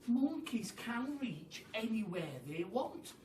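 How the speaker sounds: ordinary delivery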